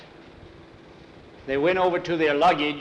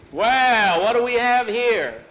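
bully taunt; 8 semitones higher, 6 dB louder, slower than usual. Acted. Bully confronts the new boys.

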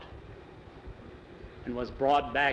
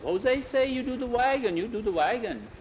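put-on high accented voice quoting the boss; mock the boss's broken English; Mexican accent; 9 semitones higher than usual. A narrator's reading. Pedro quoting Jose's order in a high Mexican accent.

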